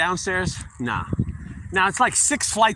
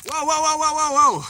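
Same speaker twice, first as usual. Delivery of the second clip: high, sudden repeated whoas; startled whoa; 11 semitones higher than usual. Real, not acted. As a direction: yelp as water hits you. Sprinklers soak the phone.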